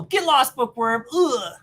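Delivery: snooty brush-off. Acted. Girl voiced brushing Peter off.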